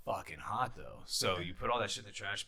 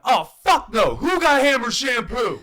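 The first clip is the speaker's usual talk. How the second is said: much higher, much louder yelled outrage; outraged shout; 17 dB louder than usual. Retold or imitated. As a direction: bellow it for the whole block to hear.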